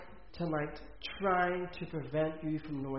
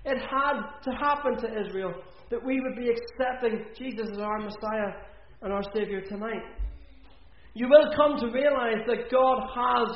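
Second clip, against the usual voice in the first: higher, louder preaching that builds to the altar call; rising pulpit fervour; full-force preaching; 4 semitones higher, 3 dB louder than usual. Acted. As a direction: preach it out to the hall.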